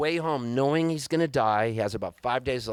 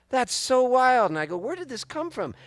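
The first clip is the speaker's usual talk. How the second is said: amazed; 11 semitones higher than usual. Retold or imitated. Amazed at the poster.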